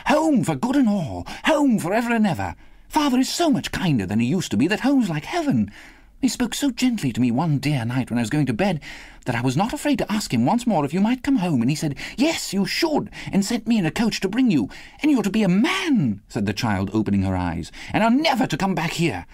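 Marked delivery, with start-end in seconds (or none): 0.1-16.2 put-on high, eager little girl, words tumbling; brimful of glee. A narrator's reading. Little sister tells brother of father's kindness, gleeful. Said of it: "brimful of glee". 17.9-19.2 put-on high, excited little-girl chatter; brimful of glee. A narrator's reading. Little sister promises Christmas together, gleeful. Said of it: "brimful of glee".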